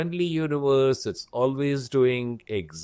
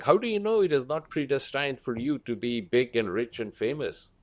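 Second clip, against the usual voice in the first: ordinary delivery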